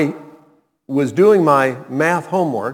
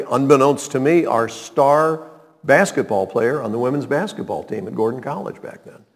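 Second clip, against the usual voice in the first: ordinary delivery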